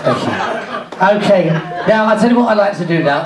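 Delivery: normal talking voice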